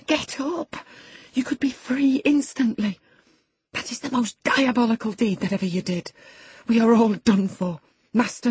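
high, loud, frantic scolding; passionate. A narrator's reading. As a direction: berate him as disaster closes in. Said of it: "I said passionately".

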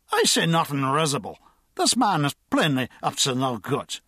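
indignant mock-grand drunk; pompous drunk. A narrator's reading. Drunk insists to the constable, indignant and pompous. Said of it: "said the drunk".

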